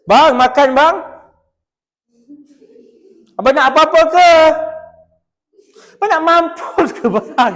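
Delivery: high, loud teasing wife calling her husband; a hammed-up wife pestering a sulking husband; mock nagging wife. Wife nags silent husband. (isteri berleter kuat dan tinggi kepada suami yang diam)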